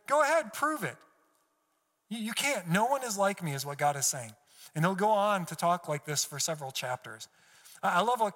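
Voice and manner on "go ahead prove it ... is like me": high-pitched taunting dare; sarcastic. Acted. God paraphrased, daring Job to prove himself, sarcastic. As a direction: goad them, sure they will fail. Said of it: "God's getting sarcastic here".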